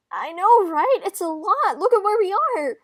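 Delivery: loud, high gushing outburst; thrilled near-shout; overwhelmed excitement. Acted. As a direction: burst out, voice shooting up. Flicker overwhelmed, bursts out excitedly.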